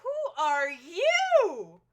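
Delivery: loud, very slow drawn-out surprise; startled loud who. Acted. Detective startled by a stranger, loud drawn-out surprise. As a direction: stretch it out in loud shock.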